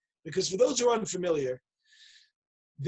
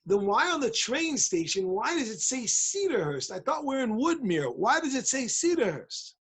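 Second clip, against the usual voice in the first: higher, sing-song mock bafflement; acted confusion, pitch swooping up; puzzled questioning; 4 semitones higher than usual. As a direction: press the question like someone truly baffled. Confused chassid questions the Litvaks.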